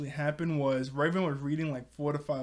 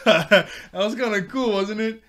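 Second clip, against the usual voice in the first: excited; 6 semitones higher, 10 dB louder than usual. Real, not acted. Excited aside about Raven.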